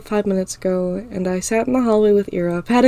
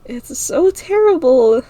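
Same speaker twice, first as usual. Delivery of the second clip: tearful complaint; 6 semitones higher, 4 dB louder, slower than usual. A narrator's reading. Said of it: "anger mixed with her tears".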